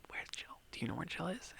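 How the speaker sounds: urgent unvoiced whisper. Friends in enemy land, searching in a frantic whisper. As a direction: hiss it so the enemy can't hear. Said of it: "they can't call out".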